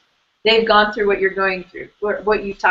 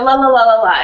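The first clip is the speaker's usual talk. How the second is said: louder, dismissive la-la-la; mock-airy la-la; 7 dB louder than usual. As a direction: brush it off with mocking la-las. Mocking a flowery, pretty ideal.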